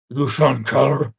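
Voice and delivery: loud, slow put-on challenge; mock angry. Another furry storms up to him, mock angry. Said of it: "came in with anger".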